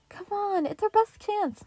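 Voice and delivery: high little-brother plea; pleading kid. A narrator's reading. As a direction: coax him eagerly. Mikey pleading.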